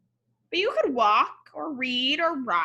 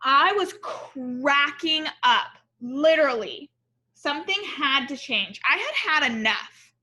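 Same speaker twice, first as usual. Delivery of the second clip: story narration; 3 semitones higher, 4 dB louder than usual. A narrator's reading. Reads picture book aloud.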